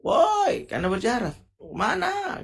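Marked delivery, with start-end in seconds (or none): 0.1-0.7 high loud call "Woi"; yelling "Woi" to someone far away; shout. Shown as a demonstration. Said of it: "kalau orang teriak". 1.6-2.4 high call "Mana?" as if across a distance; raised "Mana?". Shown as a demonstration. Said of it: "kalau orang teriak".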